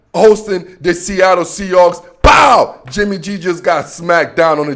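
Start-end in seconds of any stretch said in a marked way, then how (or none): 2.2-2.8 sudden high loud shout; pow shout. Acted. Host announcing the loss with a sudden loud pow. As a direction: smack it out like a punch landing.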